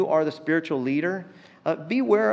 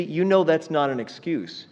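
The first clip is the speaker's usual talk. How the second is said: normal talking voice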